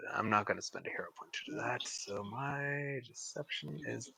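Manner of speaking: whispered aside; whispering. Real, not acted. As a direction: whisper it to yourself. Aside to self.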